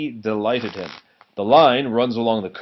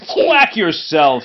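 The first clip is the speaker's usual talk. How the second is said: indignant; 5 semitones higher, 9 dB louder, slower than usual. Acted. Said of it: "said Duck indignantly".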